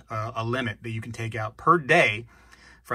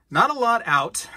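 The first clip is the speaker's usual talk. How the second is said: emphatic outburst; 10 semitones higher, 6 dB louder than usual. Acted. Vlogger showing off gold, blurting it high and loud. Repeats the phrase, first take punched.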